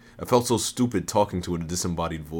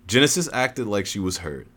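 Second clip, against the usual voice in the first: usual speaking voice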